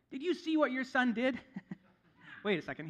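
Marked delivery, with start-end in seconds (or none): 0.1-1.4 higher, louder accusing parent; lightly voiced annoyed spouse; exasperated parent. Parent complaining to partner about their son, mock accusing. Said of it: "That's what parents say to each other".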